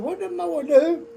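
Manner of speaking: a hammed-up old man asking what happened; demanding old man.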